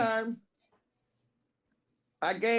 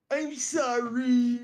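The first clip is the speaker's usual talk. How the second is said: high, very slow drawn-out apology; Roger impression; 5 semitones higher, 3 dB louder, much slower than usual. Homeless man apologizing after scolding, high drawn-out whine. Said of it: "He did it just like this".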